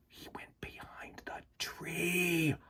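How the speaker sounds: slow, breathless whisper about the possum; whispered narration. A narrator's reading. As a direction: hush it like a secret. Tense narration, hiding possum.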